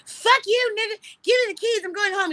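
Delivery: high-pitched, bratty outburst; tantrum. Retold or imitated. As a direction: throw a drunken fit, demanding and shrieky. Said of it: "the whole tantrum".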